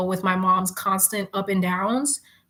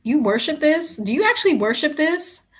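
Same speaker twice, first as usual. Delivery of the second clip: amazed questions; 6 semitones higher than usual. Real, not acted.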